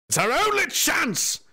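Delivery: high, drawn-out hammed-up shout; dramatic mock cry. Rallying an ally on the field, hammed-up shout.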